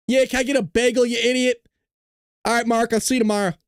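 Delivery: hammed-up New Yorker at the bagel counter; attempted New York accent. Customer ordering a bagel in New York, attempted New York accent. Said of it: "I don't know what accent that was".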